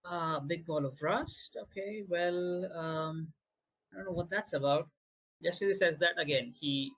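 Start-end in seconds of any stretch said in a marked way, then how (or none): 0.1-4.9 put-on childlike puzzlement. GM in NPC voice, puzzled.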